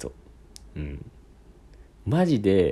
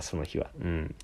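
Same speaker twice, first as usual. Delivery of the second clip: ordinary talk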